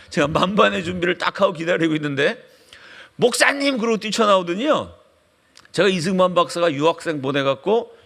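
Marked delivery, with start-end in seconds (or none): 3.2-3.8 high-pitched mock call; imitated shouting. Principal rushes out to the waiting pastor, imitated shouting. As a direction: holler it as if charging up. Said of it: "소리를 지르면서 뛰쳐나오는 거야".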